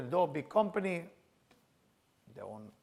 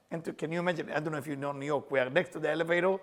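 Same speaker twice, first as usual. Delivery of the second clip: normal talking voice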